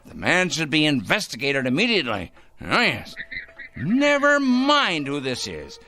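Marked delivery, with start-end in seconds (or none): 3.8-5.8 high, pointed and emphatic; anonymous caller bristling at the superintendent; insistent snap. Acted. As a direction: brush off the question firmly.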